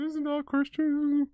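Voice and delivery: high, loud, drawn-out mock outrage; goody two shoes. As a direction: squeal it slowly like a prim scold. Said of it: "your goody two shoes be like".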